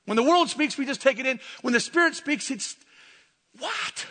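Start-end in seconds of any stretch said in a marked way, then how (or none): 3.6-4.1 high, soft, puzzled squeak; acted high-pitched disbelief; mock bafflement. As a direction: act totally thrown by what you heard. Acts bafflement at the Spirit.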